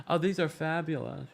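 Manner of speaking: soft, slow, refined fabulous; hushed mock-elegant admiration; pretend fancy. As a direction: play it cool like old money. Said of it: "pretended like I seen this before".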